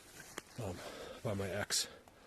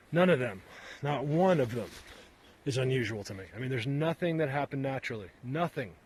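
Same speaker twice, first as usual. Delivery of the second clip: getting mad; 2 semitones higher, 4 dB louder than usual. Real, not acted. Arguing with doubters about fallen trees, loud and heated. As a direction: push hard, you are fed up. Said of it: "Sorry, I get mad".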